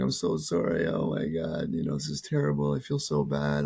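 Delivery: lightly acted distress, pitch swinging up and down; imitated tearful apology. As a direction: imitate someone apologizing through tears. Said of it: "She starts crying".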